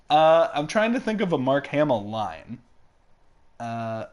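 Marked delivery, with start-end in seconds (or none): none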